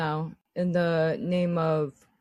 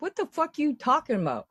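incredulous; 9 semitones higher, much faster than usual. Retold or imitated. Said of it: "I'm like".

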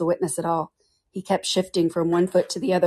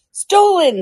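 high, loud shocked cry; exclamation; 12 semitones higher, 6 dB louder than usual. Acted. The king accusing the Hatter before the jury, shocked loud cry. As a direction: blurt it out in outrage. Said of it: "the king exclaimed".

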